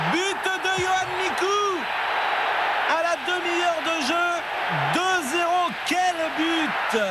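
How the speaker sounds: high goal call, building to shout; excited sports commentary. Real, not acted. Goal call of Mikou's strike.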